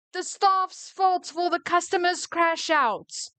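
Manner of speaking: high, outraged telling-off; so mad. Real, not acted. Boss firing an employee who blamed staff, furious. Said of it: "I am so mad".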